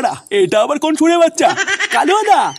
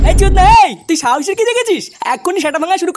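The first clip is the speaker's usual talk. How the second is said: a loud, higher furious yell; angry shout; 5 semitones higher, 3 dB louder than usual. Acted. Kaluya yells at the men who burst into his home, furious shout. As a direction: blow up at them.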